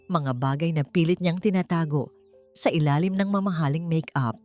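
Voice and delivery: ordinary delivery, nothing marked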